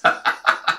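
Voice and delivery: short breathy giggle; brief titter after the joke; amused chuckle. Real, not acted. Vlogger amused by demand for props, short giggle.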